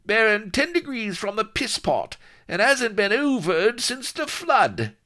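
very high, loud crewman calling from a cramped space; indignant underling, shouted high; complaining pirate sidekick. A narrator's reading. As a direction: grumble loudly in a squeezed high voice. Vladimir calls from under bed.

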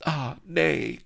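the lady begging for the hero's life; pleading cry. Acted. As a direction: cry out to stop the fight. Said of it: "cried Vanity".

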